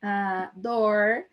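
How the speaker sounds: "door" with an interior paulista r; drawn-out English "door" in a countryside São Paulo accent. Shown as a demonstration. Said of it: "e aí em inglês eu falo".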